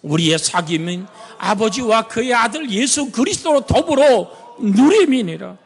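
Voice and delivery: ordinary delivery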